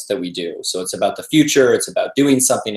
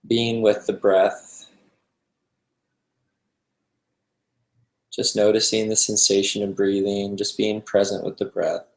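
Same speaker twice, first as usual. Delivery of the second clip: calm, unhurried meditation guide with long pauses; guided meditation. Acted. Teacher guiding a group to relax into the breath, calm meditation voice. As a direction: ease them gently into stillness, leaving space between phrases.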